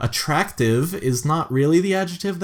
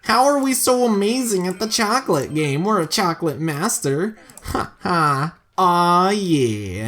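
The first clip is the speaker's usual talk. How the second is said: nasal voice; 5 semitones higher than usual. Acted. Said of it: "that really nasally voice".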